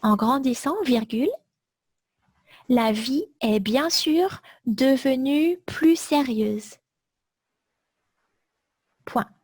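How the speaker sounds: chunks repeated, punctuation read out; slow dictation. Shown as a demonstration. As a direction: dictate it slowly for note-taking. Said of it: "Je répète tout le texte".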